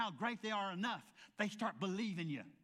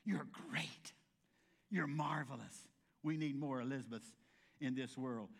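warm affirming; 6 semitones lower than usual. Retold or imitated. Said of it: "Elizabeth immediately said".

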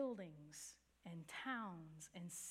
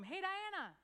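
higher, louder call across a room; 11 semitones higher, 5 dB louder than usual. Retold or imitated. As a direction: hail her over the noise. Coworker calls across coffee shop.

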